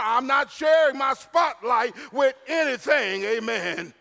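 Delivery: loud, high-pitched pulpit delivery; ringing sermon voice with amens; full-force preaching. Acted. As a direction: preach it hard, punching the key words.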